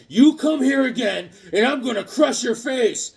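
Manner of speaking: high, loud threat; threatening hero. Acted. Hyperion threatens Overmind.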